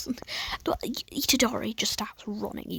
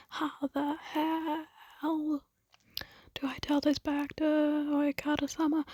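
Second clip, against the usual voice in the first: higher, slow worried voice; hammed-up anxious fretting, pitched up; fretful Gyomei; 5 semitones higher, slower than usual.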